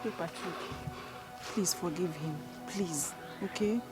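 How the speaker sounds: hushed, low entreaty; soft pleading. Acted. Bystander begging Patrick to forgive him, soft pleading. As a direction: plead softly and slowly.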